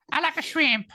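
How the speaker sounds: high mock wife voice; whiny wife impression. Husband imitating his wife's shrimp craving, high and whiny. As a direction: whine it like a petulant wife.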